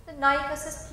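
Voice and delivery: ordinary delivery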